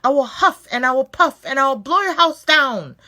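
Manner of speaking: loud, high roaring threat; roaring shark. Acted. Upset shark roaring at the pigs, loud and high. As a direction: bellow the threat at the house. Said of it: "screamed the furious magic shark in his loudest voice".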